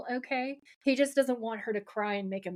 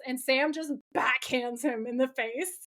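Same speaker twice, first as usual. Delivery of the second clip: usual speaking voice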